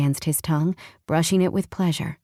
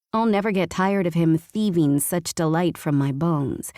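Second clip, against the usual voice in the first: ordinary delivery, nothing marked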